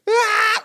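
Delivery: high, loud wailing outburst; sudden mock-hysterical shriek. Drug lady at the car window, mock-hysterical wail. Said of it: "crying hysterically".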